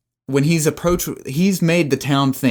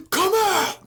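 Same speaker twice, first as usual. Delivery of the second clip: high sing-song call. Acted. Hunter calling into the woods, high sing-song call. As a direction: coax the creature out in a high call.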